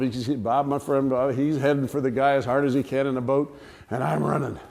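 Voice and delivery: usual speaking voice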